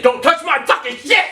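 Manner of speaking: high, rising mock yell; retold angry warning. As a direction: snap it as a territorial threat. Retells warning the bunkmate.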